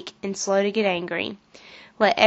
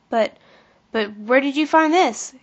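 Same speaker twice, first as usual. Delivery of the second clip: stammering; 4 semitones higher than usual. A narrator's reading. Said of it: "Kathy stammered".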